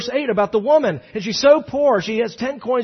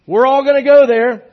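loud, higher-pitched mock proclamation; acted boastful cheer; 6 semitones higher, 10 dB louder than usual. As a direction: proclaim it like a self-satisfied dinner guest. Guest boasts in acted reply.

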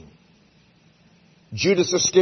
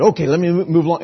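flustered retort; 5 semitones higher, 7 dB louder, much faster than usual. Retold or imitated. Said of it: "And so I said".

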